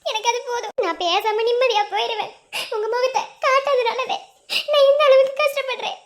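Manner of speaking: impassioned, sobbing plea; tearful pleading. Acted. Heartbroken admirer begging to see a face, tearful. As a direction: beg desperately through tears, voice surging.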